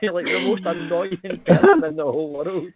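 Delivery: high, laughing retold rant; hammed-up fed-up friend, pitched high; mock exasperated yell. Friend blaming him not smoking, high laughing mock rant.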